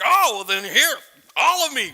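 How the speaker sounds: loud, acted burst of enthusiasm; eager surrender. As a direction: offer yourself up all at once. Peter swings to eager acceptance.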